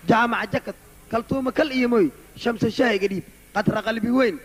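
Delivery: usual speaking voice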